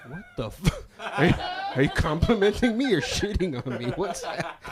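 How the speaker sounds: laughing disbelief. Retold or imitated. Comedian reacting to backhanded compliment, laughing high squeal. Comic outraged reply.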